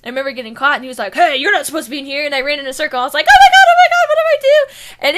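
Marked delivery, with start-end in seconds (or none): 1.1-2.3 guard voice. Retold or imitated. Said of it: "My brother did the voice of one of the guards". 3.3-5.0 much higher, much louder mock panic; panicked shriek. Caught by the game guard, mock panicked shriek.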